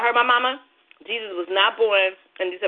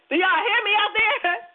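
pitched way up, slow call to the crowd; slow high-pitched call out to the audience; 11 semitones higher than usual. Real, not acted. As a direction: sing it out high to the listeners. Calling out to the audience.

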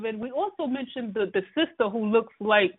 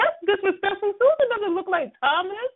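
indignant; 10 semitones higher than usual. Retold or imitated. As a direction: act scandalized and correct him. Retelling own indignant retort.

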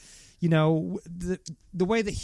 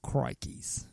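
soft, low Aussie exclamation; mock Australian; 5 semitones lower, 9 dB quieter than usual. Heckling the squinting theory, soft mock-Australian. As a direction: slip in a hushed cartoon-Aussie catchphrase.

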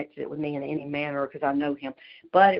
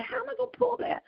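high squeaky panicked question; mock panic. Asking the range officers how she'll manage, high panicked squeak. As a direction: squeal it in comic dismay. Retelling her panicked reply.

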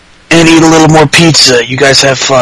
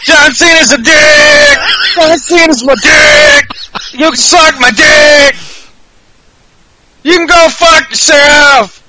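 much higher, slow hammed-up yelling; mock John Cena shout; 11 semitones higher, slower than usual. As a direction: scream it like a crowd-baiting heel, stretched. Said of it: "I couldn't even begin to do John Cena's voice".

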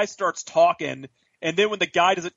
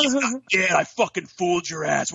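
mocking Jack Bauer gloat; 3 semitones higher than usual. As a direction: crow it in a put-on voice, pitched up. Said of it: "He's like".

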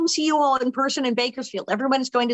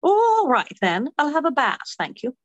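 high-pitched London pub lady; hammed-up British "all right then"; London accent; 4 semitones higher than usual. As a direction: accept in a swooping British accent. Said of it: "I'll do the London accent".

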